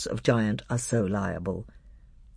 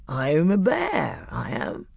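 slow, drowsy bear; thick, sleepy voice; 4 semitones higher than usual. A narrator's reading. Bear introducing himself to Peter, slow and sleepy. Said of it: "sleepy voice said".

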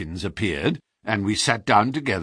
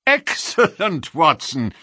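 triumphant delight; 7 semitones higher, 5 dB louder than usual. A narrator's reading. Holmes reads the letter to Watson, triumphant and pleased. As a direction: crow over the letter. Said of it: "burst out into a triumphant chuckle".

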